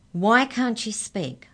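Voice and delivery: slow, high, loud demand. A narrator's reading. Arthur demanding answers from the giants, slow and loud. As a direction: press them hard for an answer. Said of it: "demanded Arthur".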